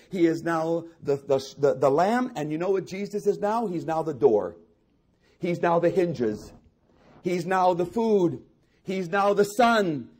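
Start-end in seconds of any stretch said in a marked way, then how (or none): 5.4-10.1 slow, rising to a louder, higher climax; preacher building to a climax, louder each line; building preaching. Real, not acted. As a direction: build to a full-throated preaching peak.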